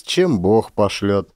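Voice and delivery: plain reading voice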